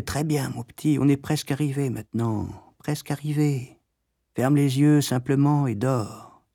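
soft, lulling, as if far away; hushed, dreamlike reassurance; distant soothing voice. A narrator's reading. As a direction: lull a child under anaesthetic, slowing at the end. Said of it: "À des kilomètres au loin, une voix disait".

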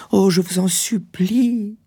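high, slow, drawn-out plea; anguished high-pitched entreaty; imploring cry. A narrator's reading. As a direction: beg him in a very high wife's voice. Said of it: "s'écria-t-elle".

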